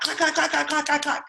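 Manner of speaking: high, quick mimicked heel clacks; clacking heels. Running to fetch the ring, she mimics her heels clacking, high and quick.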